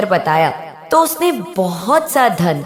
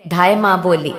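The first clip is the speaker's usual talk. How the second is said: plain reading voice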